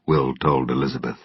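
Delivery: ordinary delivery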